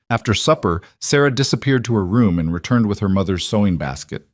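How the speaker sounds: plain reading voice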